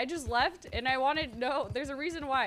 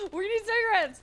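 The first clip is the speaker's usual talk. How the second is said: laughing; 10 semitones higher, 7 dB louder than usual. Real, not acted. Nervous at crowd.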